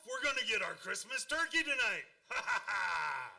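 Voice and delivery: chuckling through the line about the turkey; laughing. Acted. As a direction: laugh with delight at the plan. Dad excited about the turkey.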